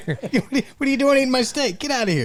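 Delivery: very high, fast hammed-up indignation; mock outrage. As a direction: sputter like a shrill fake diner. Mock diner confronting stranger.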